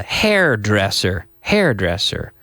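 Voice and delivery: usual speaking voice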